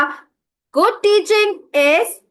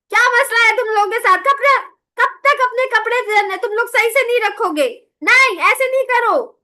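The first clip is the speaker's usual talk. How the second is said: scolding yell; 6 semitones higher than usual. Shown as a demonstration. Teacher showing how yelling at children sounds, angry. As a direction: nag the kids, fed up. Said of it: "मैं चीखती रहूं, चिल्लाती रहूं".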